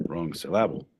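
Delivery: normal talking voice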